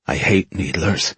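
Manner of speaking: ordinary delivery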